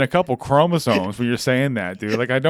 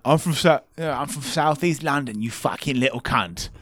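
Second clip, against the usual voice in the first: softer mock London gangster accent; Guy Ritchie British accent; 4 dB quieter than usual. Host mocking guest's accent, Guy Ritchie British. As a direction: do a bad British tough guy. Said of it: "Guy Ritchie impression".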